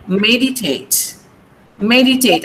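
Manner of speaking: "meditate" stressed on the opening ME; "meditate" with weight on ME, light ending; stress on first syllable. Shown as a demonstration. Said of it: "the stress will come here".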